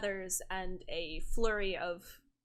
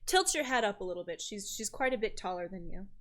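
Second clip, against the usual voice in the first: normal talking voice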